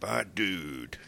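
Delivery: lightly voiced cartoon stoner, quietly; Otto. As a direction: try out a stoner bus driver. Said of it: "That was a little better".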